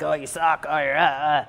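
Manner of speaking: low, quiet mock griping; mock putting yourself down, trailing into grunts; self-torturing. Beating herself up mid-workout, mock low grumbling. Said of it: "that's where we torture ourselves".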